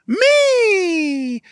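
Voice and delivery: high, long held "me" that glides down; drawn-out exclamation. Real, not acted. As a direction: sing out the last word, high and slow. Repeats the signature drawn-out cry.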